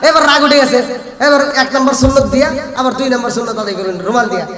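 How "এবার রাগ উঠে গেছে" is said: ordinary talk, nothing marked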